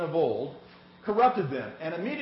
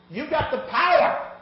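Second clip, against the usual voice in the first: rousing; 8 semitones higher, 7 dB louder than usual. Real, not acted. Preacher rallying the congregation.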